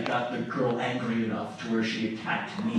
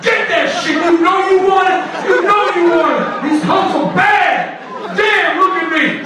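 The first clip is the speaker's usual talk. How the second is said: street hawker yelling; 11 dB louder than usual. Retold or imitated. Fur coat hawker yelling at the street crowd, hammed-up shout. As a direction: bellow the sales pitch at passersby. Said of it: "This guy's pitch was amazing".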